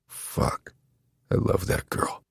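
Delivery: whispered, tender inner thought. Acted. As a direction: breathe it out softly to yourself.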